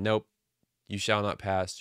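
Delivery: ordinary talk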